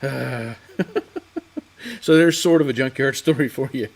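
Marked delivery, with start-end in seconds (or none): 0.8-1.6 a high, amused chuckle at himself; high laugh. Real, not acted. Host laughs at his own junkyard dream story, high amused chuckle. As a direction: laugh at how silly the dream was.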